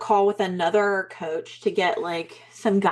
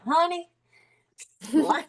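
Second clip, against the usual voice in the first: put-on Southern accent; 9 semitones higher than usual. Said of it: "And then other people are like".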